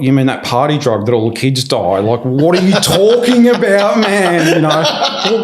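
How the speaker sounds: high, laughing mock disbelief; rising into a laughing, incredulous squeal. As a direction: squeak it out like it's absurd. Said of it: "I was like".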